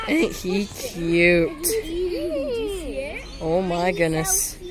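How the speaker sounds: slow high cooing over an animal. Real, not acted.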